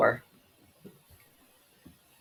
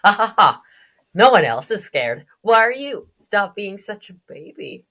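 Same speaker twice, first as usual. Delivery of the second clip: loud jeering laugh, then higher taunts; mocking bully; 6 semitones higher, 9 dB louder than usual. Bully telling kid to stop being a baby, mocking. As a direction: jeer at a scared child. Bullies mock crying kid.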